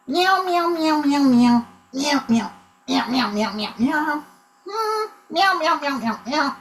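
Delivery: high, quick little mews; kitty cat meowing. Pretend. Improviser mimes a cat career for teammate, high meowing. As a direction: mew in short runs like a kitten. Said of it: "but in kitty cat form".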